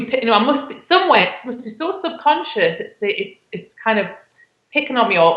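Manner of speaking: normal talking voice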